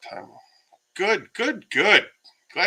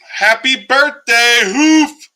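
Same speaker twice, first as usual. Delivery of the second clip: big, loud, slow birthday shout, pitched higher; excited call-out; 10 semitones higher, 13 dB louder, much slower than usual. Real, not acted. Host shouting happy birthday to a viewer, loud and excited. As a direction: belt out a cheerful birthday wish.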